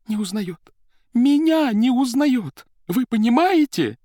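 high, loud tearful wail; sobbing out. A narrator's reading. Secretary to the accountant, mock sobbing high wail. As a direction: burst into tears on the last words. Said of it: "взрыдалась секретарь".

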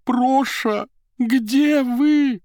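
high, loud musical call; tender tearful call. A narrator's reading. Secretary calls to the empty suit, high tender cry. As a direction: call sweetly for him after crying. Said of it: "музыкальным, нежным голосом, немного гнусавым после плача".

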